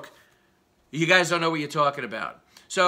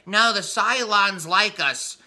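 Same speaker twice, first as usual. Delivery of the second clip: slow, higher, simple-minded voice; mock naive dummy; 4 semitones higher, 3 dB louder, slower than usual. Ranter mocking Schumer as the naive Battlestar character, slow and dim. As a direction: drag it out like a gullible fool. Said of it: "that fucking dummy on Battlestar Galactica who's like".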